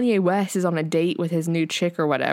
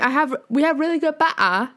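higher, louder, hammed-up Bristol accent; mock Bristol accent; 7 semitones higher, 3 dB louder than usual. Imitating Love Island cast in a Bristol accent. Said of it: "harsh British Bristol accent".

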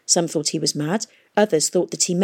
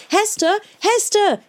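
high, loud calls of her name; shouting; 3 dB louder, slower than usual. A narrator's reading. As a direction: call her name across the platform. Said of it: "he shouted".

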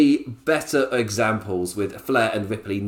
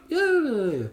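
mock high yeah. Mock dismissive aside.

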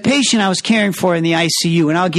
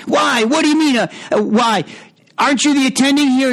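indignant daughter; 8 semitones higher than usual. Retold or imitated. Daughter snapping back at the attending, high and indignant. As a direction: throw the question back in scorn. Daughter voiced yelling at doctor.

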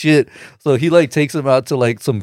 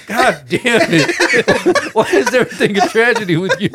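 laughing quote; 13 semitones higher than usual. Retold or imitated. Movie character covered in ash, exasperated, voiced laughing. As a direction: crack up while imitating an exasperated friend.

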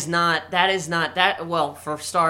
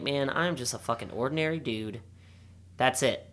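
glum muttering to self; 5 semitones lower, 8 dB quieter than usual. Retold or imitated. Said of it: "I was like".

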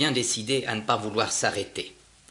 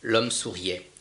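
ordinary delivery

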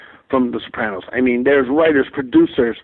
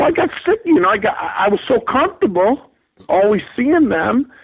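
sheepish excuse; 8 semitones higher than usual. Retold or imitated. His sheepish excuse to wife.

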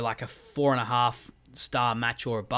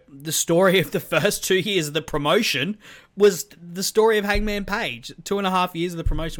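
excited; 6 semitones higher, 6 dB louder than usual. Real, not acted. Gushing about Hangman's story.

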